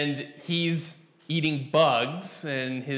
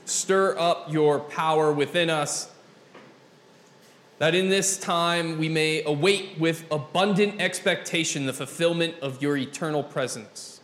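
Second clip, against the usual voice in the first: measured pastoral prayer with long pauses; public prayer. Real, not acted. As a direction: pray aloud for a congregation, phrase by phrase. Leads congregation in prayer.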